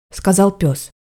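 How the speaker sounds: ordinary delivery, nothing marked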